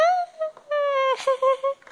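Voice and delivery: shrill burst of laughter; high laugh. Acted. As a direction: laugh out loud in a high squeal. Laughing at a fall. (risada aguda e alta)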